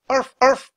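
high yappy arf, arf; dog bark. Acted. Teddy the dog barking at monkeys, yappy bark. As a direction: bark like a small excited puppy. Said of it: "barked Teddy".

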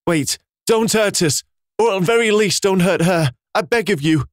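put-on father pleading with a beast to spare the baby; trembling plea. A narrator's reading. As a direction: beg for your child's life. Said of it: "trembling as he uncovered their newborn".

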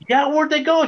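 high-pitched retold amazement; amazed question. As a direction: ask it in high, mildly acted wonder.

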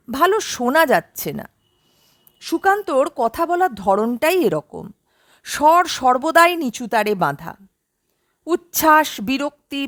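ordinary delivery

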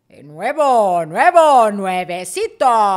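loud, booming station jingle voice, last word slowed; hammed-up radio sweeper shouting nuevo; radio announcer tag. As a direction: stamp the station tag over the song like a DJ. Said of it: "metía la cuchareta el locutor".

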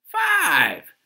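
very high, loud cry dropping at the end; neighbor's loud exclamation. A narrator's reading. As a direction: exclaim in exaggerated amazement. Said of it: "exclaimed Miss Whistler".

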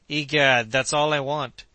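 loud, exclaimed retort; emphatic retort. Acted. Kennedy seizes on Joe's objection, loud and emphatic.

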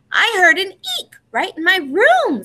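high small cub voice; a frightened little bear cub whispering to his brother; little Baby Bear. A narrator's reading. Baby Bear in bed telling big brother about the noise, high small voice. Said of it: "said Baby Bear from deep down".

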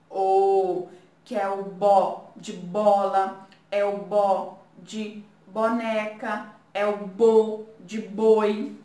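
"bó" of bola, boneca, boi hit loud; stressed syllable bo. Shown as a demonstration. Teacher to pupils, stressing each bó syllable. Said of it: "O B com".

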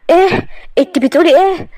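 put-on high, loud stunned young man; young man hearing shocking news; shocked. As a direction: react in disbelief. Hossam hears shocking news.

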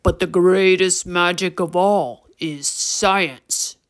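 deep gravelly troll, slow and weighty; low, rough creature drawl; Torque. A narrator's reading. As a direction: pronounce it slowly like a wise old troll. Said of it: "deep and gravelly voice".

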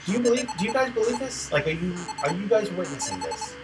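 high-pitched disbelief; incredulous. Real, not acted. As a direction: squeal it in disbelief. Disbelief at the game to chat.

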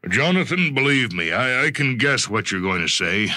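head him off with a knowing smile; lightly voiced executive. Bartholomew heading off Jonathan's confession, lightly voiced.

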